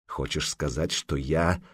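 soft, low, slow insinuating question; silky menace. A narrator's reading. Jarl quietly menacing the giant who called him a woman. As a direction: ask it quietly with veiled threat. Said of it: "вкратчиво поинтересовался Храгнир Ярл".

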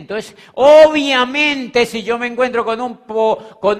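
alto, fuerte y muy lento; énfasis enfático. Real, not acted. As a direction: arranca con fuerza predicadora. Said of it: "énfasis predicador". ("Entonces, obviamente" remarcado fuerte y lento)

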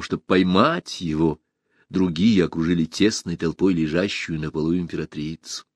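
ordinary delivery

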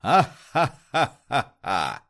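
slow, booming evil cackle; movie villain laugh. Shown as a demonstration. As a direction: laugh like a mustache-twirling villain. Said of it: "громко засмейтесь, как злодей в кино".

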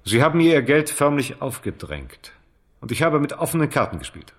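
raising his voice against the accusation; indignant. Acted. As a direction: push back hard, rising anger. Heated quarrel over money.

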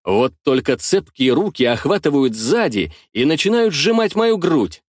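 ordinary delivery, nothing marked

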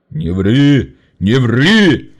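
loud, sharp rebuttal; indignant denial. Acted. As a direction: shut him down. (возмущённо)

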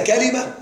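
كلمة as ka-li-ma, three short syllables; first dialect form. Shown as a demonstration. Teacher lists three variants of the word, كلمة pronounced kalima. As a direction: say كلمة with a on kāf and i on lām, kalima. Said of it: "عندنا ثلاث لغات في كلمة".

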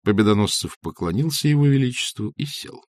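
usual speaking voice

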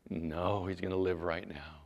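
low, soft, assured answer; calm correction. Acted. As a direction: drop low and state it quietly. Jesus calmly corrects the sister.

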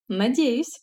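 teasing smirk in the voice; wry, amused. Acted. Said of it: "хмыкнула".

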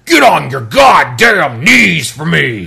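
slow, loud menacing shout; brute yelling. Retold or imitated. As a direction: bellow it like a raging giant. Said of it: "they'll start yelling things like".

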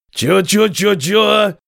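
growing fury. Acted. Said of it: "свирепел на глазах Костоправ".

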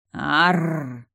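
loud rolling Arrrr growl; tree's roaring growl. Acted. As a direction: roar like an angry old tree. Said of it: "А это уже кричало дерево".

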